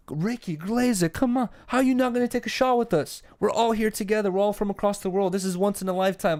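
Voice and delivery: high-pitched, insistent mock coaxing; persuasive Russian host. Russians urging a visitor to take a shot, mock persuasive. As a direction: act out peer pressure, voice pitched well up. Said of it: "Russians can be very persuasive".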